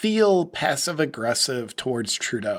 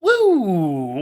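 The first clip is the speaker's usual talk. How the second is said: loud cheer, higher and louder; excited whoop; 5 semitones higher, 5 dB louder than usual. Real, not acted. Vlogger cheering after the clip of the woman, loud whoop. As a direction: whoop like a fan in the stands.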